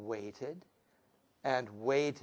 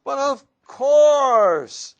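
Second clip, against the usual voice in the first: high, loud and slow, a fox hamming it up as a duck; silly stranger duck voice; 12 semitones higher, 17 dB louder, much slower than usual. Acted. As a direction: boom it out like a proud fake duck. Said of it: "said the stranger with a waddle and a strut".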